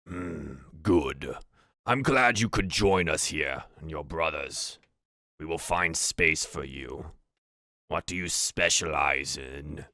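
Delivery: low, slow orc voice; measured orc leader receiving a newcomer. Acted. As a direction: speak low and unhurried as an orc chief. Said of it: "Valrul answers in Goblin".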